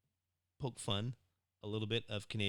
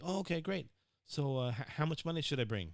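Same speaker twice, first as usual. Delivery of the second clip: eager younger self; 5 semitones higher than usual. Retold or imitated. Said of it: "and I'm like".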